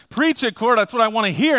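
loud, high cheering congregant; mock eager amen. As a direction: cheer the preacher on, hammed up. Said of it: "some of you are saying".

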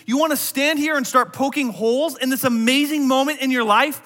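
high, loud, challenging questions; stern rebuke. Acted. Angel scolding doubting Zechariah before shutting his mouth, stern rebuke.

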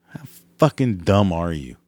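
usual speaking voice

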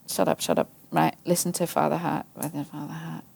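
low, soft, almost breathless shush to herself; hushed self-scolding. Retold or imitated. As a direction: hush yourself sternly under your breath. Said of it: "so then it's like".

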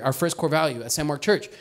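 ordinary delivery

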